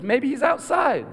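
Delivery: high, singled-out innocent suggestion; feigning grandma. Retold or imitated. Grandma cooking misleads searching children, high and innocent. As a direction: pitch it way up, all innocence.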